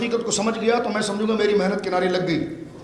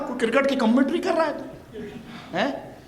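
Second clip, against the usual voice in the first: normal talking voice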